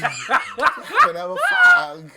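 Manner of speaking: loud, high mock-British repeat; drunk British man. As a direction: blare it in a mock UK accent. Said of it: "he was wild drunk".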